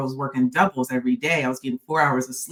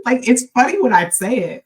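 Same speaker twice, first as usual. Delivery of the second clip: real laughter through the words; can't stop laughing at yourself; laughing while talking; 9 semitones higher, 7 dB louder than usual. Real, not acted. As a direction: giggle your way through it. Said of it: "the laughing is".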